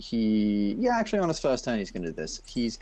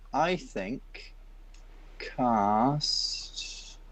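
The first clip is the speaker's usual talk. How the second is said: ordinary talk, nothing marked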